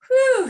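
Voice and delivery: high loud whew of relief; relieved whew. Retold or imitated. Mother hearing her child's "bad word" was only shut up, relieved whew. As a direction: blow out a big relieved whew.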